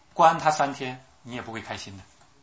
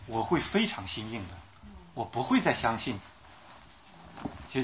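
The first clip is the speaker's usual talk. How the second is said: ordinary talk, nothing marked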